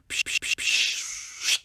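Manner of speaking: unvoiced psh sounds; hissed splashing noises. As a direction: mimic flicking water. Imitating water splashing.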